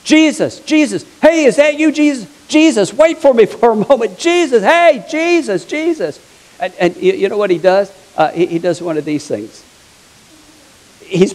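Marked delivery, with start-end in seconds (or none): 0.0-6.2 high, insistent calls after someone; raised-pitch, urgent shouting for attention; calling out. Acted. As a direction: hail someone who is walking away. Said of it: "all of a sudden he hears this voice".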